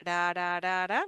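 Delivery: "ra" repeated with a quick single tap; flapped R. Shown as a demonstration. Teacher showing the middle R of atorar, as flap T. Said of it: "like the flap T".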